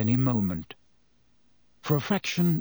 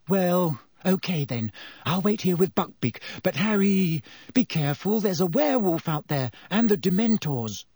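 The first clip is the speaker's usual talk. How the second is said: higher, anxious warning; worried Hermione; 6 semitones higher than usual. A narrator's reading. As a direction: let him go but fear for him.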